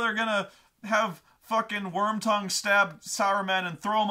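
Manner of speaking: hammed-up indignant tirade; red rage rant. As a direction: imitate an angry fan on a rant. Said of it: "going into the Red Rage".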